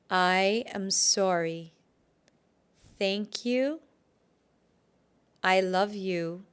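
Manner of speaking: slow, measured phrases with long pauses; repeat-after-me affirmation. Shown as a demonstration. Healer holds the cord with tenderness, slow repeat-after-me affirmation. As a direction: say each phrase slowly, leaving room to repeat. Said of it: "repeat after me".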